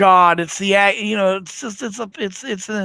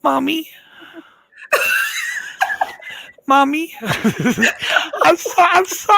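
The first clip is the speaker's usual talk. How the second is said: high laughing wail of Mommy; laughing so hard the words squeak out; real laughter; 7 semitones higher, much slower than usual. Real, not acted. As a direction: crack up helplessly. Laughing at own mishap.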